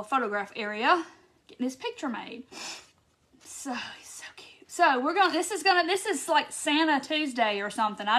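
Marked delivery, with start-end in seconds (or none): 3.4-4.7 soft breathy whisper to the hippo; cooing whisper. Real, not acted. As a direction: whisper it as if not to wake him. Cooing over the cute hippo.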